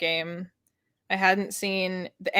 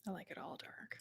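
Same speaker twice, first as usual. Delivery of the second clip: breathy mock-ominous whisper; 2 semitones lower, 26 dB quieter than usual. Friend confesses grimdark taste in a whisper. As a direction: drop to a secret whisper.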